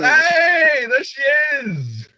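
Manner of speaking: high, drawn-out cheer of welcome; excited greeting. Acted. Friend hailing Mel as she runs up, excited. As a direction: hail a friend with a big high holler.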